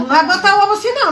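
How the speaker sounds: high, fast scolding call; scolding shout. Real, not acted. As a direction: snap a warning across the kitchen. Mother scolding the cooking.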